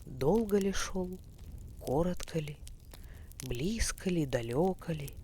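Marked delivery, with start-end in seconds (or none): none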